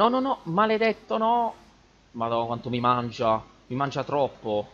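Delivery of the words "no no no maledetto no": disperato. Real, not acted. Being beaten by the boss.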